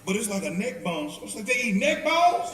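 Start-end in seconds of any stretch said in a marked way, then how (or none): 1.5-2.5 higher, louder and slow disbelief; mock amazement, drawn out; amazed question. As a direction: gasp it out, laughing. Shocked at neck bones.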